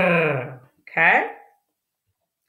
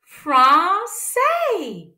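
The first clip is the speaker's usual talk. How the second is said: Français said much higher, then falling; high final take; 11 semitones higher, 3 dB louder than usual. Shown as a demonstration. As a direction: sing Français high and let it drop. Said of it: "Let's put it all together".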